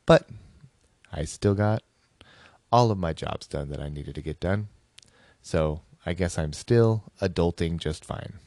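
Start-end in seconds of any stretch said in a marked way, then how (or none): none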